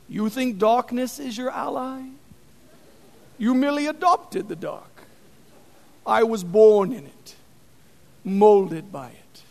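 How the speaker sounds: menacing villain, lightly voiced; Bane impression. Preacher imitating Bane for his youth group, lightly menacing. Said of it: "I'll do my Bane impression".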